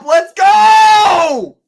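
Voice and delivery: loud, high cheer to kick off; excited shout. Real, not acted. Streamer cheering loudly to start the live. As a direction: hype up the viewers with a shout.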